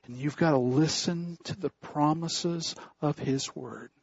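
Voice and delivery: ordinary talk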